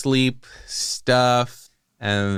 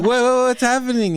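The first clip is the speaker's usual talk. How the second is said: high, louder stammering panic; panicked confusion; 5 dB louder than usual. Retold or imitated. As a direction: stammer it out, scared awake.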